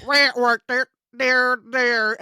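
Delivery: low mock guitar twangs; imitated guitar noise. Mocking an actor's fake guitar chords, imitated guitar noise. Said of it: "but instead it's like".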